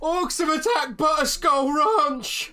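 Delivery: high, loud cry of alarm; alarmed shout. Acted. Messenger bursts into the inn, panicked shout. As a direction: burst in yelling the terrible news. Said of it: "bursts through the, uh, door".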